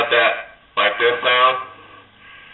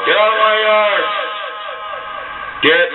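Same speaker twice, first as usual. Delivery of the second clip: put-on high, cranky old man yelling; grumpy old man; 9 semitones higher than usual. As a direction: shoo the kids off his lawn. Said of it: "I used to do that with echo mic mode".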